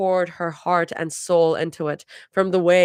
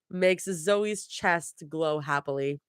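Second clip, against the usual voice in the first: usual speaking voice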